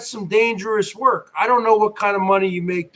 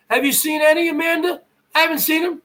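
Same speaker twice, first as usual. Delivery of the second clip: normal talking voice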